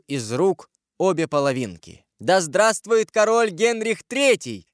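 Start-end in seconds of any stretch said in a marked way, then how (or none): 2.2-4.7 high, slow proclaiming shout; cried acclamation. A narrator's reading. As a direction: hail the new king in a ringing cry. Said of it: "крикнула Екатерина". (торжественно выкрикнуть)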